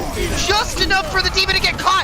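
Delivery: high-pitched, urgent live match call; excited play-by-play. Real, not acted. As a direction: call the action at full excitement.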